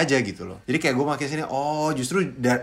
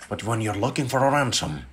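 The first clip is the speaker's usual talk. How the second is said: Liam Neeson; 2 semitones lower, 4 dB quieter than usual. Shown as a demonstration. Father warning a kidnapper, measured menace. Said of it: "Liam Neeson".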